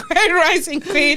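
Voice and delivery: laughing through a mock greeting; teasing laughter breaking into the words. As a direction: giggle while imitating the pickup line. Mocking men's pickup line.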